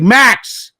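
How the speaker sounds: name barked high and loud; sharp call. Retold or imitated. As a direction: call him out firmly. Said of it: "I was like".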